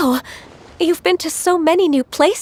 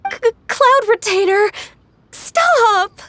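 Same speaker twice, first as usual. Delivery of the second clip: flustered, higher pleading cry; embarrassed protest; 6 semitones higher, slower than usual. Acted. Ganyu begs her master to stop telling childhood stories, flustered. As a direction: beg her to stop, mortified.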